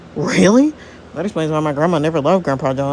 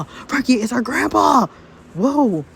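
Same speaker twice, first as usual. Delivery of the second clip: higher, drawn-out realization; slow, raised-pitch disbelief; dawning amazement; 5 semitones higher, slower than usual. Acted. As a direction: stretch it out in wonder. Same discovery, drawn out.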